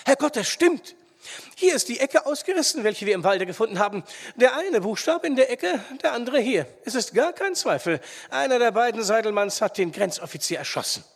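loud, high-pitched burst of discovery; startled loud exclamation, pitched high; excited outcry. A narrator's reading. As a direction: cry it out, sure of the find. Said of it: "stieß Wunderlich einen lauten Schrei aus".

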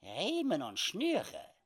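put-on high, slow old trapper; calm squeaky trapper plan. Sam quietly gives the plan.

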